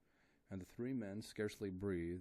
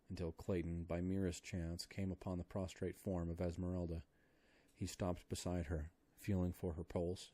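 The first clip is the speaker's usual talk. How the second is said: ordinary delivery, nothing marked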